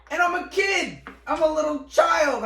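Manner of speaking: high, loud incredulous yell; outraged shout. Real, not acted. Storyteller protesting a teacher threatened a child, outraged high shout. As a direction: yell it in comic disbelief, pitch shooting way up.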